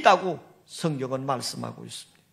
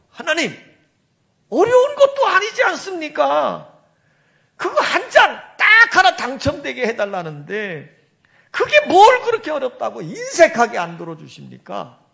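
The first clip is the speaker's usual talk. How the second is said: higher and louder, arguing with God; indignant protest; 5 semitones higher, 5 dB louder than usual. Retold or imitated. Old rabbi argues with God over the lottery, indignant and loud. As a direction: demand an answer, half laughing in disbelief. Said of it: "하나님께 따지는 거예요".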